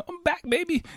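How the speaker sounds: high, hammed-up victory cry; gleeful boast. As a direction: crow it like a champion. Celebrating trip to China at home.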